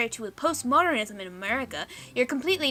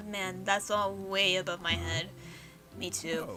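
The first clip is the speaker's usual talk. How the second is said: ordinary talk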